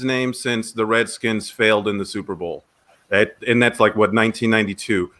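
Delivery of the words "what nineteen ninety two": normal talking voice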